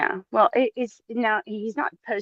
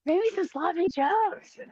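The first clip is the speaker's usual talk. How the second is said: high, slow mocking put-on voice; 11 semitones higher, slower than usual. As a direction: drawl it high like a silly impression. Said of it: "But it's like".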